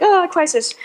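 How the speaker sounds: hammed-up, light distress; mock alarm. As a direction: play up a little panic. Acting out own dismay at leak.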